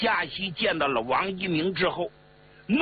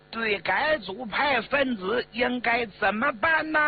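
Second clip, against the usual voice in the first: public denunciation; 7 semitones higher than usual. Acted. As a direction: stretch each phrase to rouse a crowd. Leader denounces him before the crowd.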